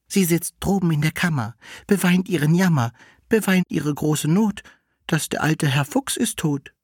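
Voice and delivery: high, rhyming cat voice; high-pitched lamenting verse; maid cat. A narrator's reading. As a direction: recite the woe high and rhythmic, as the cat.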